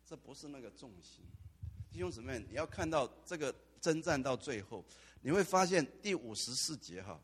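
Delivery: ordinary talk, nothing marked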